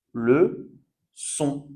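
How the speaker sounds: "Le son" as two separate syllables of leçon; soft C. Shown as a demonstration. Teacher showing learners leçon with cédille, soft C. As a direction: say "Le son" split apart, with a clear S. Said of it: "ça change le son".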